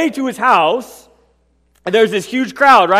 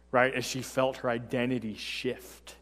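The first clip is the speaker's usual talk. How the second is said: usual speaking voice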